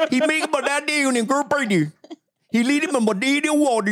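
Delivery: rising and falling gibberish recitation; gibberish Bible reading. Pretend. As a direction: recite scripture as rubbery nonsense syllables. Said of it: "they should have a Bible version of this".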